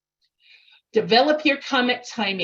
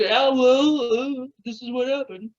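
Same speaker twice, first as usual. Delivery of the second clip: uncaring dog; 2 semitones higher than usual. Retold or imitated. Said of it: "Maybe he doesn't care".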